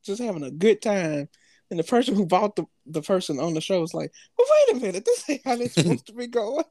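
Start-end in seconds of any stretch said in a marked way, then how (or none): 4.4-6.6 pitched-up startled "wait a minute"; hammed-up indignant surprise; mock dismay. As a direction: play the shocked partner catching on. Acting contestant's dismay.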